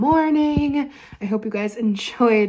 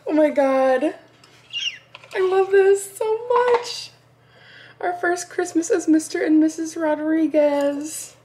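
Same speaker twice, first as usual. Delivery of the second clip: overjoyed; 7 semitones higher than usual. Real, not acted. Seeing a first married Christmas gift, overjoyed. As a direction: draw it out high, overcome with delight.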